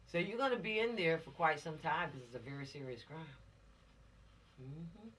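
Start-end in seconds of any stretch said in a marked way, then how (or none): none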